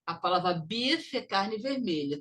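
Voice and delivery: ordinary delivery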